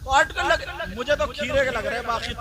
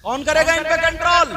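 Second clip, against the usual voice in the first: higher, louder demanding question; loud challenge; 7 semitones higher, 11 dB louder than usual. Real, not acted. Preacher demands an answer from the listeners, loudly. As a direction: fling the question at the crowd.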